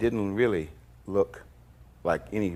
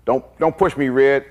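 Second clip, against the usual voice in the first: a raised, threatening don't push me; loud warning; 6 semitones higher, 8 dB louder than usual. Retold or imitated. [name] warning [name] not to push him, loud and threatening. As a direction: warn him off, louder and higher.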